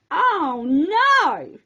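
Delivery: a touch of alarm, not a full shout; mild cry of alarm. A narrator's reading. Zack sees his beans burning, cries out mildly. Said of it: "he cried out".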